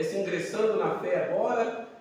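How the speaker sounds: ordinary talk, nothing marked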